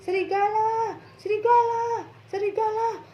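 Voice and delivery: high, loud call of wolf; boy crying wolf, loud and high; shouted cry for help. Acted. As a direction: yell it out across the fields. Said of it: "Teriaklah dia".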